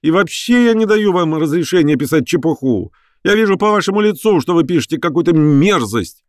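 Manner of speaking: high-pitched angry scolding; indignant wail. A narrator's reading. Professor forbidding reporter to write, wailing in outrage. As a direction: rail at him in a high voice. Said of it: "завыл Персиков".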